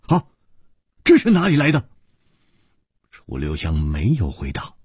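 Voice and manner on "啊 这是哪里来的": high, sharp question; stern, startled demand. Acted. A swordsman pales at the bronze token, demanding sternly. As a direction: demand it sharply in sudden alarm. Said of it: "一点红骤然失色， 厉声说".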